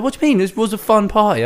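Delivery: high, baffled put-on voice; mock confused friend. Friend baffled by her denial in a high mock voice. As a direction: sound puzzled and clueless.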